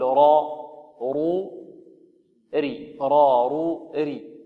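را and رو heavy, ري light; ra vowel drill. Shown as a demonstration. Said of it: "تقول". (تمرين الراء بالفتح والضم والكسر)

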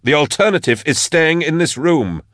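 panting from kicking; breathless after hard effort; out of breath. A narrator's reading. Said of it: "breathing hard from the exertion".

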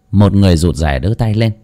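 usual speaking voice

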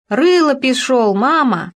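put-on high, loud happy child's call; joyful cry. A narrator's reading. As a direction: call out gladly like a little girl. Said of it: "Ребёнок радостно вскрикнул". (радостный детский вскрик)

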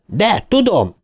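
a sharp high retort; angry shout. A narrator's reading. Bill shouts angrily at his friends. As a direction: snap back at them all. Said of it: "Bill mérgesen kiáltott rá mindannyiukra".

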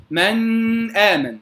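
من آمن slowly, noon hummed long before the hamza; over-held ghunna. Shown as a demonstration. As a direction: wrongly drag the nasal noon of من before آمن. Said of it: "ولا أقول". (مد غنة نون من آمن)

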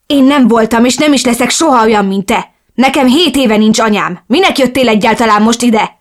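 dühös ordítás; screaming, distraught. Acted. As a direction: scream it at her, losing control. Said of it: "ordítottam kiborulva".

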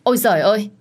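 shocked exclamation on collision; startled cry. Retold or imitated. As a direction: cry out as the coffee spills. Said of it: "kèm theo tiếng kêu của tôi".

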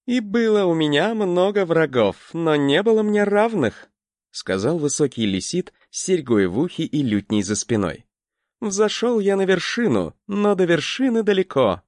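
a proud bard declaiming; pleasant bard voice. Acted. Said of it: "Его голос был приятен".

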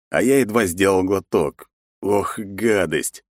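usual speaking voice

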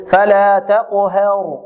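تقهر with the fatha of ه tilted toward e; tilted vowel. Retold or imitated. Teacher mimicking readers who over-thin the haa, tilted vowel. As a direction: imitate the mistake, ه in تقهر leaning to "he". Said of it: "فيميل فتحتها، فيقول".

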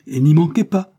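loud, high repeated command; insistent repeat. A narrator's reading. Ebenezer repeating the court summons to Afi, loud and insistent. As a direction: press the order home forcefully. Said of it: "répéta monsieur Ebenezer".

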